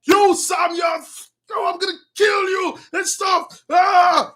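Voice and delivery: high, strained threat of a madman; angry yelling. Acted. Saul threatening to kill Samuel, angrily yelling. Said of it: "He was yelling, screaming, he was angry".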